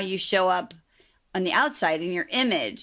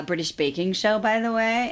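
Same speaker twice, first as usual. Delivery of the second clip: ordinary talk, nothing marked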